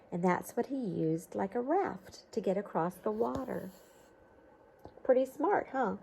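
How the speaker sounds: lively storybook read-aloud; reading to children. A narrator's reading. Teacher reading a storybook to children, lively read-aloud. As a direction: read it like a picture book to kids.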